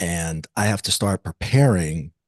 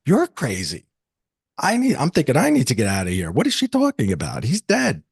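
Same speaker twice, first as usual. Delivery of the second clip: pitched-up disbelief, rising to the end; retold incredulous alarm; 5 semitones higher than usual. Man thinking he must get out, incredulous and alarmed. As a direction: protest as if wanting out of there. Recalls panicked disbelief at her claim.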